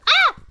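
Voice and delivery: cri de surprise perçant; un petit cri aigu de surprise. Real, not acted. Cri soudain en jouant. (cri perçant)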